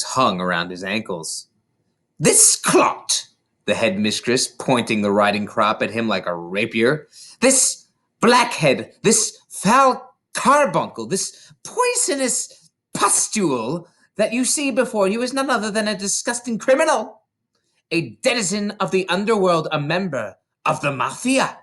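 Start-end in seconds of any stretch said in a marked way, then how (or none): 2.2-3.3 much higher, louder sneer; contemptuous denunciation. A narrator's reading. Headmistress denounces boy to school. 7.4-21.6 scornful tirade. A narrator's reading. Headmistress denounces boy to school.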